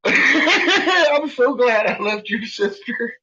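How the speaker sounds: high, drawn-out quip through laughter; laughing retold quip. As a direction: quote yourself while cracking up. Laughing reply in retold talk.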